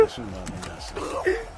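very high airy laugh into slow breathy words. Real, not acted. Streamer cheering a rival's trick, breathy laughing. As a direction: laugh out the words, barely voiced.

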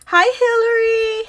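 high, loud delighted greeting; excited squeal. Real, not acted. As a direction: squeal a hello to a friend. Greeting a viewer on a live stream.

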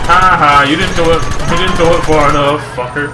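taunting. Real, not acted. Taunting an enemy in game.

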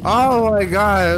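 loud slow startled gasp. Real, not acted. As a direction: blurt it out in real shock. Startled by jump scare.

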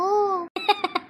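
a quiet chuckle; quiet amused laugh; soft laugh. Real, not acted. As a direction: laugh softly under the breath. Laughs at fart joke.

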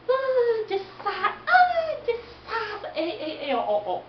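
high squealing cheers; excited crowd cheer. Acted. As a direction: cheer her on wildly.

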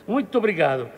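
ordinary talk, nothing marked

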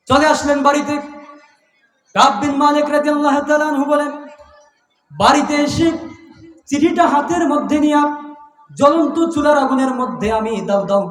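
high chanted sermon narration; sustained high-pitched storytelling chant; impassioned preaching. Acted.

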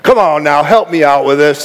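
normal talking voice

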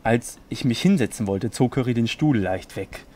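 low, hushed storyteller reading; serious bedtime-story narration. Acted. Reads fanfiction aloud.

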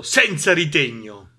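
ordinary delivery, nothing marked

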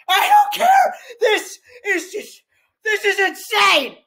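high, impassioned protest; worked-up outburst. Real, not acted. Viewer answering a comment about getting too excited, worked-up outburst. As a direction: burst out, then stretch the words in disbelief.

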